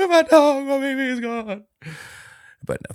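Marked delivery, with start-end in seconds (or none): 0.1-1.6 high-pitched hammed-up crying; mock wailing. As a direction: sob it in fake despair, half laughing. Mock wailing over lost dog.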